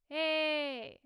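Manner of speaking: loud, held, piercing call; belted twangy shout on one vowel; edge with twang. Shown as a demonstration. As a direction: squeeze the back and ring it out. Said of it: "really squeezed as if I'm talking with a lot of twang".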